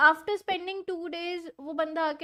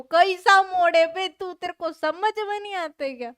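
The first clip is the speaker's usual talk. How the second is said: loud, higher exasperated telling-off; exasperated scolding; 4 semitones higher, 8 dB louder than usual. Real, not acted. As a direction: snap at the viewer who won't listen. Scolding a chat viewer. (झुंझलाकर ऊँची आवाज़ में डाँटना)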